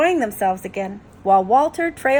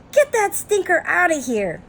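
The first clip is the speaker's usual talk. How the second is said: high-pitched squawky parrot complaint; indignant parrot; 8 semitones higher than usual. Acted. Parrot shouting in a story.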